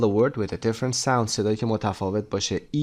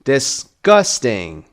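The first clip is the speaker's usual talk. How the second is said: Disgusting very slow, gus louder; stress on gus; 5 dB louder, much slower than usual. Shown as a demonstration. Teacher stretches Disgusting slowly to show the stress on gus. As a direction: slowly pull Disgusting apart and lean on gus.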